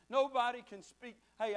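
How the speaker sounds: ordinary talk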